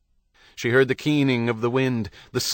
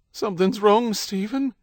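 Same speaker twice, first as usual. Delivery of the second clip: high, frightened plea; breaking into a wail; 10 semitones higher than usual. A narrator's reading. Wife in early labour warning her husband, wailing. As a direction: let alarm climb into a wail. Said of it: "Her voice degenerated into a wail".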